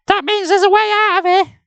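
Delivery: put-on very high girl voice; high-pitched girl talking; girl's voice. A narrator's reading. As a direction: say it as the young girl.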